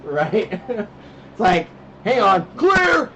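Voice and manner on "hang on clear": high, loud, drawn-out "clear!"; mock emergency call. Dad joking after tranquilizer remark, pretend loud medic call. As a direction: play a medic calling clear. Jokes about tranquilizing the kids.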